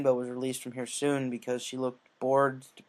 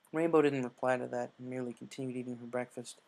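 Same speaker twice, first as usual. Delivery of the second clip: ordinary delivery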